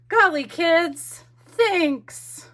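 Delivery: high, loud, slow character voice; hammed-up high, loud gratitude; acted excitement. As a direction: draw it out like an overexcited lady. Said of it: "She's so excited".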